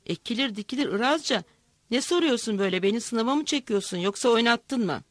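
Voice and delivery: ordinary delivery, nothing marked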